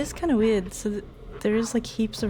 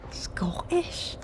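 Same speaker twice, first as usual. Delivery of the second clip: whisper; 7 semitones higher, 4 dB quieter than usual. Acted. Spooky whisper at ghost box.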